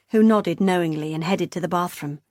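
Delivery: usual speaking voice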